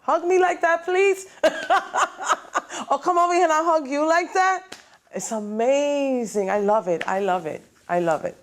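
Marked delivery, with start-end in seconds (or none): 0.0-4.8 much higher, laughing gush of delight; thrilled reaction. Retold or imitated. Son reacting to the record, high giddy laughing delight. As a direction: squeal it out through laughter. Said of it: "And I'm like".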